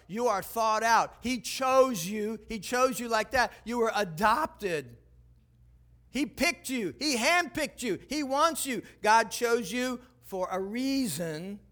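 preacher insisting on being chosen; slow emphatic preaching. Acted. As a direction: drive home that they are wanted.

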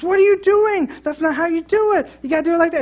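husband imitating his wife telling him he did it wrong, high and sharp; imitating wife scolding. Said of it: "She says".

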